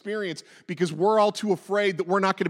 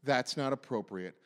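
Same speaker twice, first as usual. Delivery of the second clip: pursed-face voice; 5 semitones lower, 6 dB quieter than usual. Acted. Pursed-face voice scolding in the back alley, disapproving. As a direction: drop low and soft, primly disapproving. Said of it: "with the pursed face voice".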